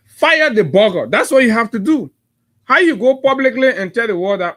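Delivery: loud, high-pitched outburst; indignant demand. Real, not acted. Host demanding a minister be sacked, indignant. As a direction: hit it hard and high, demanding action.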